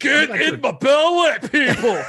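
loud, high, drawn-out mock Scottish shout; hammed-up Scottish accent. Co-host clowns after being told he went Scottish, hammed-up Scottish accent. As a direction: bellow it in a bad Scottish accent. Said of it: "No, you went Scottish".

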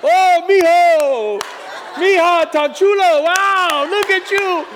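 high, loud, gushing auntie shout, laughing; proud aunties cheering. Retold or imitated. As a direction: squeal like a proud tia on the sideline. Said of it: "the tias and the T Os on the sideline".

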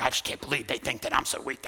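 low, soft, scornful imitation of a woman; mild mocking voice; mock whiny complaint. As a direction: sneer her complaint back at them.

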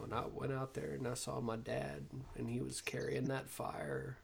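quiet retold monologue. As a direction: hush it like the film's last scene. Tommy Lee Jones dream speech.

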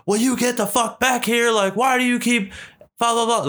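high, strained mock yell at no extra volume; imitated exasperated husband. Husband calling his wife back from the kids, mock yell.

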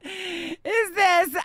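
high tearful laugh; a squeak of laughter through tears; laughing cry. Real, not acted. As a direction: laugh while choked up.

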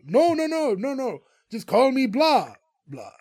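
losing patience, insist hard; rising exasperation at repeating himself; exasperated correction. Retold or imitated. Count Blah correcting Greg again.

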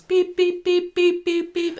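high, sing-song beep beep notes; mimicked phone beeps. Recapping Daisy's phone flooding with messages, high mimicked beeps. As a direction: chirp like incoming message alerts.

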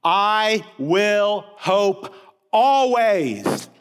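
slow soaring proclamation; stress on always. Shown as a demonstration. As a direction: stretch always long and rising in power. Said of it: "the writer declares".

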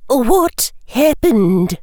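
high, slow, drawn-out question; concerned professor. Acted. Professor asking a tearful pupil about trouble, high and slow.

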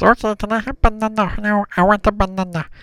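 very high little monkey voice; high, garbled Stitch-like pleading; Bob. Acted. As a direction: beg like an eager cartoon stuffed monkey. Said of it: "And then he goes".

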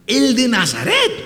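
high, loud, slow amazed question; astonished, drawing it out; surprised. Acted. Blind man hears Jesus is near. (sorpresa alta y lenta)

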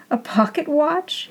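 high, mocking sneer of a question; derisive. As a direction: scoff at the cheap watch. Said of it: "he said derisively".

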